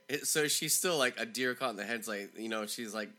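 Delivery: plain reading voice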